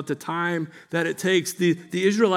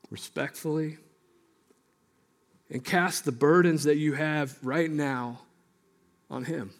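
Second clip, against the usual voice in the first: guided prayer; 3 semitones lower, 6 dB quieter than usual. Acted. Pastor leading the congregation in quiet reflection, hushed.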